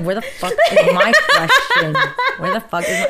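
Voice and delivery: loud, high retold demand, laughing; laughing mock outrage. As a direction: imitate his outburst while cracking up. Mimics William, cracking up.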